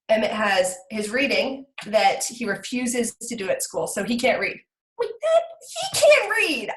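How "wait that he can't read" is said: much higher, louder protest; indignant outburst. Real, not acted. Mother protesting about her son's reading, indignant. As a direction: burst out in high-pitched disbelief. Same words repeated as outburst.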